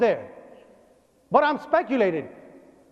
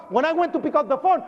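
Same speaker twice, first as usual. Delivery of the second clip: ordinary talk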